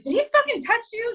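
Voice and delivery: high-pitched mock-alarmed demand; imitating someone shocked and concerned; alarmed question. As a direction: retell it as an urgent, protective question. Retelling Frank's alarmed question.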